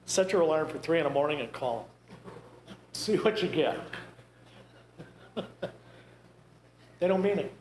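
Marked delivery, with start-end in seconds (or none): none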